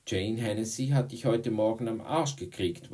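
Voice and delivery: taunting interjection. Acted. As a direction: throw it at him across the room.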